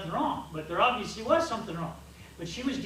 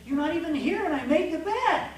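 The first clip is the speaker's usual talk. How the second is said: higher and louder, mock outrage; mock accusation; 8 semitones higher, 6 dB louder than usual. Husband on phone to wife away, mock-outraged. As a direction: playfully accuse her of ruining you. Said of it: "You've ruined me".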